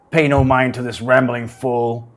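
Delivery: gruff dismissive dwarf. Acted. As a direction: brush her off like a dwarf. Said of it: "says the, the dwarf".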